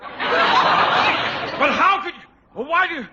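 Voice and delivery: sputtering outrage. Acted. Principal sputtering over strike news.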